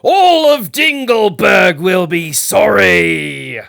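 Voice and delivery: slow, loud, high threatening shout; wizard shouting. A narrator's reading. Wizard on his ship threatening the barber, shouting. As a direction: hurl the threat back over the water. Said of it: "the wizard shouted back at Seville".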